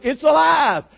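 high, loud repeated cry; imitated madman hollering to the crowd; screaming street showman. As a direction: shout it out while running in circles. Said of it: "this guy's screaming at the top of his lungs".

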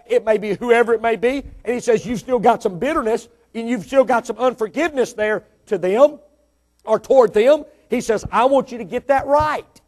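loud, high, insistent exhortation; preach. Acted. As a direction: press the charge home, building phrase by phrase.

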